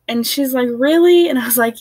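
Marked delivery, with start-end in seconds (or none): 0.7-1.3 high, loud startled question; a grandmother who can't believe it; bright astonished really; surprised. Retold or imitated. Grandma hears the pregnancy news.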